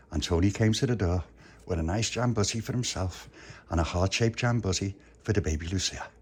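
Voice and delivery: ordinary delivery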